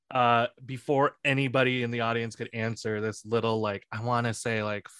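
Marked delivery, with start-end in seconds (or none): none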